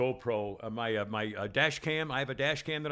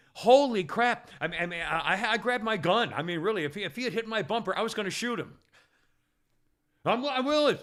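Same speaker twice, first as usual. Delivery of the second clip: high, raised voice, ranting in disbelief; real agitated, high-pitched near-yell; worked-up yelling; 8 semitones higher, 4 dB louder than usual. Real, not acted. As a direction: get carried away and yell the story. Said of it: "Sorry, am I yelling? I think I'm yelling".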